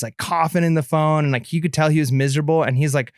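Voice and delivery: ordinary delivery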